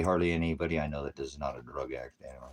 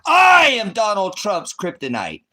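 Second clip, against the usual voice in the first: much higher, much louder, slow declaration; booming slow boast; grand proclamation; 14 semitones higher, 13 dB louder, slower than usual. Acted. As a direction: announce it like a superhero revealing himself. Proclaiming himself Trump's kryptonite.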